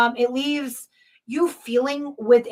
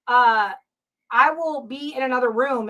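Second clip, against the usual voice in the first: usual speaking voice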